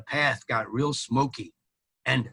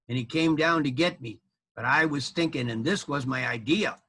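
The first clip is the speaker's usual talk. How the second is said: ordinary delivery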